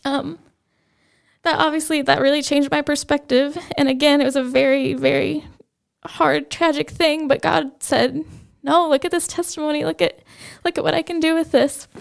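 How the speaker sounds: real tearful voice, pitched high; holding back tears. Real, not acted. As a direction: keep talking while fighting off crying. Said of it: "ooh, sorry".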